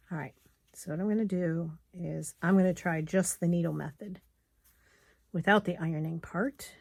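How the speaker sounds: ordinary talk, nothing marked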